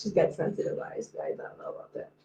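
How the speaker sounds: low, near-whispered doubtful aside; hushed aside. Real, not acted. Confiding to viewers about friend's eyes, hushed sceptical aside. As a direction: drop to a whisper, confiding in the audience.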